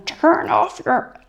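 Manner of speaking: high, slow pleading; high urging plea. Retold or imitated. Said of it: "what everybody was going".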